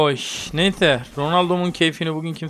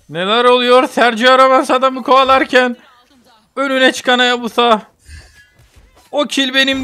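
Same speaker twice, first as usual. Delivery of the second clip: high, loud play-by-play; sports commentator; 6 semitones higher, 5 dB louder than usual. Pretend. Streamer narrating the team fight to viewers, excited commentary. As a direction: call the action like a match commentator.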